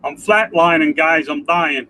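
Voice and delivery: normal talking voice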